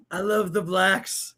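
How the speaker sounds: slow mock presidential voice; Donald Trump. Son walking in doing his go-to presidential impression, slowly. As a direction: draw it out as a parody president. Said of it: "If he can do the Donald".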